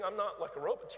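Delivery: ordinary talk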